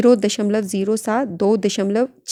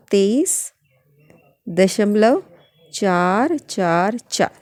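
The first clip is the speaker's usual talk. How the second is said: slow dictation; much slower than usual. Shown as a demonstration.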